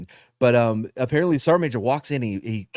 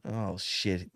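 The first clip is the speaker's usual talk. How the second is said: quiet dread; 7 semitones lower, 11 dB quieter than usual. Retold or imitated. Caught by the general.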